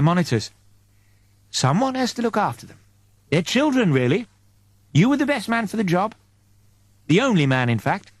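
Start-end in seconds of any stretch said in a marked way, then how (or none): 1.5-8.1 lightly voiced angel, higher and gentle; an angel fondly explaining the crew; angel's voice. Angel telling Eddie why he was chosen, lightly voiced.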